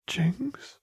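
high, hesitant worried question; timid call of her name; scared. Acted. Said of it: "Lux's voice sounded scared".

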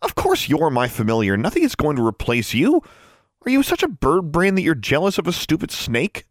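high-pitched, indignant telling-off; exasperated scolding. Acted. Girl scolds her raven after being pecked, exasperated. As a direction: scold a jealous pet, pitch climbing.

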